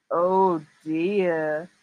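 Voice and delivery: ordinary delivery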